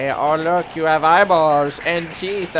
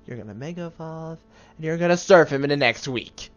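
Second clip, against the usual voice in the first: ordinary talk, nothing marked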